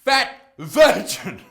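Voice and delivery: loud, slow, drawn-out taunt; hammed-up scornful jeer; mocking insult. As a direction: hurl each word separately with relish.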